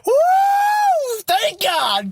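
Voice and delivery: high-pitched whoop of relief, then thanks; a sudden celebratory yelp; joyful whoop. Retold or imitated. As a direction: cheer like a weight just lifted. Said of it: "I was like".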